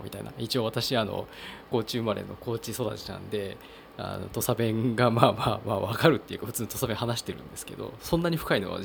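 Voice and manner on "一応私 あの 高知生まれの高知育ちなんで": normal talking voice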